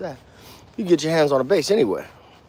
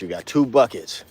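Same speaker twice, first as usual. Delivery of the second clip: ordinary delivery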